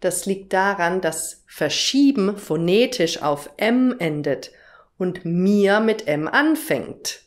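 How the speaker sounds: ordinary talk, nothing marked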